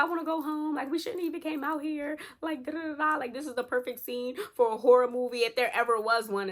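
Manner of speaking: high-pitched mock whine; imitated tearful whining. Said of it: "Jasmine starts crying".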